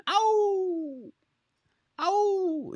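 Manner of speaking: two long high hound howls; dog howl. Retold or imitated. Hound dog on the porch, howling. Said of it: "and he's howling".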